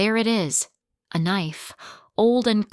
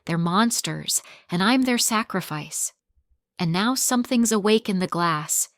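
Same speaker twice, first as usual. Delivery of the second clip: usual speaking voice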